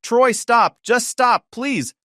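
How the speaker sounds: high, loud scream to stop; screaming plea. Acted. Wife screams at brother to stop fighting, high and loud. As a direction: shriek at him to stop. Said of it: "Bridget screamed from the bedroom".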